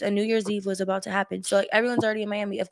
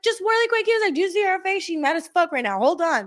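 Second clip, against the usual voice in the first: a much higher, mock-chirpy voice; imitating a teasing guy; 9 semitones higher, 3 dB louder than usual. He teases the girls about her being mad, high mock voice. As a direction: ham up his playful teasing, high and bright.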